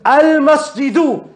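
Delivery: Al-masjidu slow, ending u sounded; clear al-masjidu. Shown as a demonstration. Teacher echoing student's Al-masjidu slowly. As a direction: model Al-masjidu carefully.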